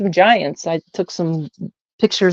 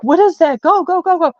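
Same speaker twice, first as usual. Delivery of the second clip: much higher, panicked retold cry urging them to go; mom freaking out; 9 semitones higher than usual. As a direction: imitate a frightened mom yelling go go go. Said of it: "she's like".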